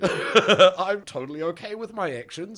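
loud, slow, exaggerated mock contrition; psychopath apology. Friend parodies someone sorry only for the crying, smug mock apology. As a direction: stretch out the fake apology, pleased with yourself. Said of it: "psychopath apology is what that is".

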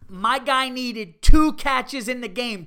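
slow, loud exasperated complaint; fantasy fan shouting over two catches; excited, loud and high. Real, not acted. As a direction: raise the voice in disbelief. Host rants about fantasy loss.

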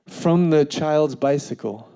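ordinary talk, nothing marked

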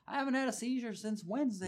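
high-pitched, thrilled announcement; imitated excited kid. Healed kid bursting in Sunday morning, imitated high excitement. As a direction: play a kid bursting with good news.

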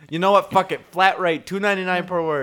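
loud, high-pitched giving up; exasperated outburst. Acted. Word seller abandons his letter count for a flat rate, exasperated. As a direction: throw up your hands loudly.

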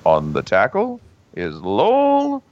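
slow, deliberate game call; very deliberate announcer. Acted. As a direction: call it very deliberately, drawing out the name. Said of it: "he will be very deliberate".

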